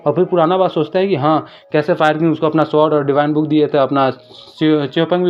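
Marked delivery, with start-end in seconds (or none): none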